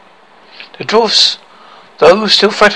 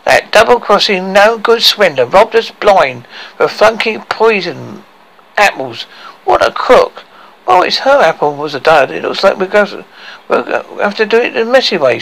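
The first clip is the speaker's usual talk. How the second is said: lightly voiced grumbling dwarf, pitched higher; indignant dwarf; 6 semitones higher than usual. Dwarfs cursing the swindler's dud poison, indignant. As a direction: rant with a touch of outrage.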